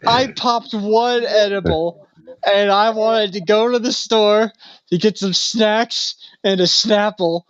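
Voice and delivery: high, slow, pleading imitation of a crying man. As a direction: drag out a tearful desperate question. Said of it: "he is just like".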